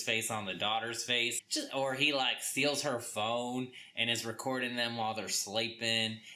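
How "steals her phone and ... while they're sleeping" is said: ordinary talk, nothing marked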